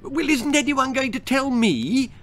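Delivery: very high petulant squeal; squealing fairy. Acted. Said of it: "squealed the Tooth Fairy".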